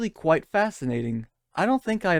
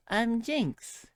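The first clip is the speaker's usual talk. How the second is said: higher, softer smug self-introduction; lightly voiced coy villain; understated smug purr; mocking Jinx; 7 semitones higher, 5 dB quieter than usual. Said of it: "And Jinx goes".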